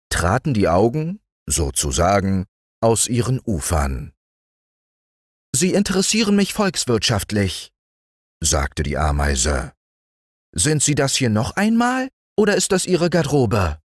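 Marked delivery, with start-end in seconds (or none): 5.5-13.8 high, quick, officious ant voice. A narrator's reading. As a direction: interrogate like a busy bureaucrat ant. Ant questioning mayfly.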